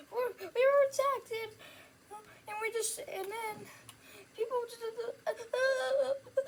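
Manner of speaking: high, loud, voice full of tears; a frightened girl on the verge of crying; distressed girl voice. Acted. Survivor girl tells of attack.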